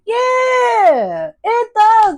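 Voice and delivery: high, loud burst of insistence; sudden loud insistence; emphatic shout. Real, not acted. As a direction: belt it out, fully convinced. Emphatic affirmation to viewer.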